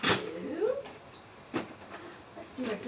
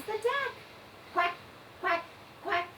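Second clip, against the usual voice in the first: playful higher voice; 8 semitones higher, 3 dB quieter, faster than usual. Acted. Playing duck for a child.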